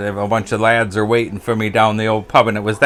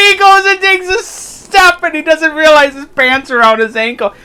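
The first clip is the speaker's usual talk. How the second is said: high, loud, slow words through laughter; laughing narration; 10 dB louder than usual. Real, not acted. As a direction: tell it while cracking up. Telling the fall through laughter.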